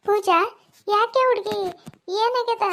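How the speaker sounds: worried calling out. Acted. Neighbour finds Pooja upset.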